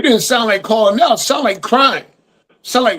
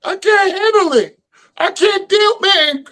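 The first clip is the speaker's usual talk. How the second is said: much higher, whiny mock sobbing voice; mock crying whiner; 10 semitones higher, slower than usual. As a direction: whimper it like a crybaby mocking the quarterback. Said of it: "he was crying".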